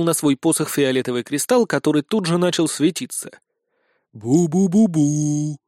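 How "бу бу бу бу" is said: slow, drawn-out ghostly "bu-bu"; wavering moan echoing down a stairwell; eerie booing. A narrator's reading. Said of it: "вновь донеслось до наших ушей". (протяжное жутковатое бубнение)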